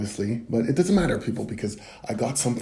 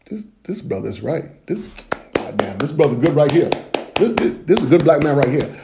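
impressed audience voice; 3 semitones higher, 5 dB louder than usual. Pretend. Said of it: "you're gonna be like".

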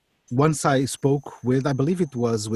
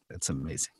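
hushed echo; 10 semitones lower, 12 dB quieter, faster than usual. Real, not acted. Repeats it, fading to near silence.

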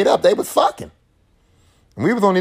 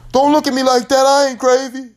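defensive Rod; 7 semitones higher, 4 dB louder than usual. Retold or imitated. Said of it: "Rod's like".

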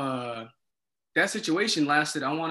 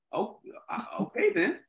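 hesitant, soft, then pitched up; retold stunned acceptance; 6 semitones higher, 4 dB quieter than usual. RA taking in news of armed suspects, stunned hesitation. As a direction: imitate a reluctant, thrown agreement.

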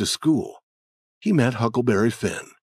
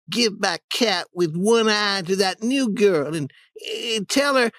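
put-on dramatic boy voice; 11 semitones higher than usual. Tom fakes dying.

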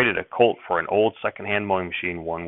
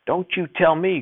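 blurted out; 10 semitones higher, 3 dB louder than usual. A narrator's reading. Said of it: "Grandfather blurted".

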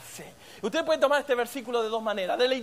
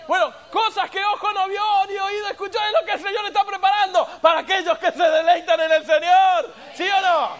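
loud, higher preaching peak; 5 semitones higher, 6 dB louder than usual. Acted. Preacher promising heaven's riches to the church, loud. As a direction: proclaim it to the church.

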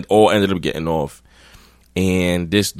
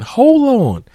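very high, louder hold on; imitating her on the phone; 6 dB louder than usual. Girl tells her phone to wait, very high mock voice. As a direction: bark it into the phone. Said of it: "she telling the phone again".

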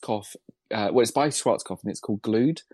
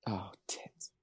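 whispered swear; 4 semitones lower, 15 dB quieter than usual. Real, not acted. Muttered swear at hard question.

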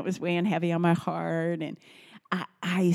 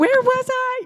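high, stretched-out mock astonishment; mock shocked mom; 8 dB louder than usual. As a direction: play the horrified parent hearing a confession. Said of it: "where I'm like".

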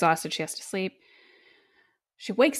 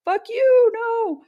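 high, loud put-on shout of refusal; mock yelled refusal; 7 dB louder, much slower than usual. Liz yelling at the priest at her car, high and loud. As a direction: hurl it at him as you speed off. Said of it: "And she's like".

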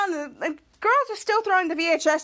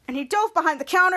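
plain reading voice

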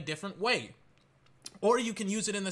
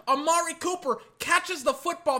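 ordinary talk

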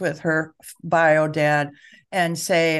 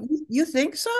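higher, gentle, genuinely curious; gentle curious question; 9 semitones higher than usual. Shown as a demonstration. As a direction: calmly ask the child back. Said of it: "And see what they say then".

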